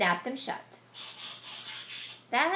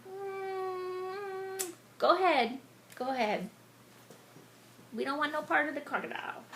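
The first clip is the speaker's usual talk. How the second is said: wary chasers; 6 semitones higher than usual. Retold or imitated. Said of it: "I think they're thinking".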